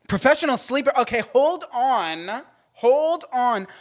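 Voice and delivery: light up at the job title; excited. Real, not acted. Reading a dream job aloud, thrilled and loud.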